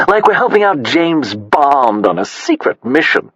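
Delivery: posh society lady. A narrator's reading. Sassy's gushing society voice.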